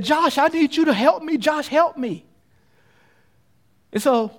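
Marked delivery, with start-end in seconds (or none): 0.0-2.2 higher, urgent begging for help; urgent plea. Acted. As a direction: plead with a friend to save you. Girl on cliff pleads with Josh.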